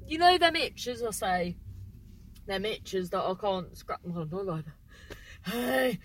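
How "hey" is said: ordinary talk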